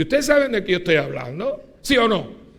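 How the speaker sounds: loud, high rhetorical questions; calling out to the congregation. Real, not acted. Preacher pressing his flock for an answer, loud and high. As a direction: demand an answer from the crowd.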